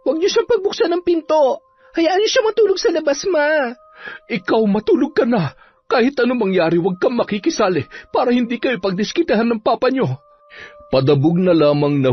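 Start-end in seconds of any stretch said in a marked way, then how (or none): 0.0-3.7 very high, hurried daughter voice; protesting daughter. Acted. Daughter protests about drunk father. 4.3-10.2 high-pitched mother voice, pleading; worried mother. Acted. Mother orders daughter to sleep.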